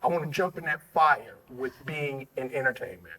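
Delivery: low, soft, measured voicing of someone's thought; hushed inner resolve. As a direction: drop low and quiet, phrase by phrase. Voices guest's decision inside question.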